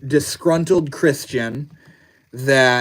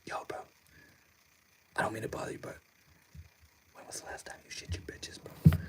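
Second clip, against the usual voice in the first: much softer, breathy whispered address; 3 semitones lower, 17 dB quieter, faster than usual. Shown as a demonstration. Streamer whispering a joke question to chat, hushed whisper. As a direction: whisper it like sneaking a secret to a friend.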